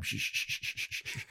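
soft run of shushes; mock soothing shushing. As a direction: hush someone repeatedly, voiceless and soft. Knight shushes the raving man.

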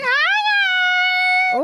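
very high, loud squeal; mock the co-host's piercingly high greeting; high-pitched mimicry. Showing cousin how the greeting sounded, exaggerated high pitch. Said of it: "It was just really high pitched".

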